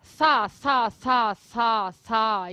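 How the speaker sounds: "sa" repeated with a hissed s; syllable sa drilled. Shown as a demonstration. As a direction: drill "sa, sa" with air between the teeth. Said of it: "tipo um". (sílaba "sa" repetida)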